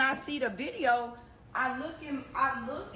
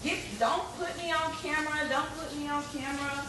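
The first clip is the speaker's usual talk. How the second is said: imitated salon lady; 5 semitones higher than usual.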